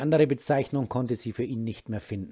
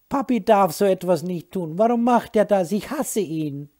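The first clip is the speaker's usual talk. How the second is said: put-on upset child; 8 semitones higher, 7 dB louder than usual. Crying son about his father.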